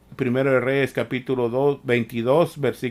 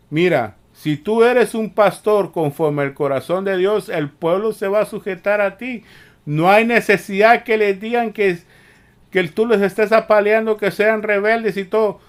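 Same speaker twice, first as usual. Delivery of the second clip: louder and higher, building to a rebuke; fiery preaching; 6 semitones higher, 6 dB louder than usual. Real, not acted. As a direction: preach it hard at the rebellious pastor. Preacher rebukes pastors at full force.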